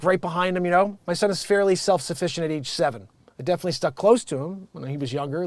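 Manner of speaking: ordinary talk, nothing marked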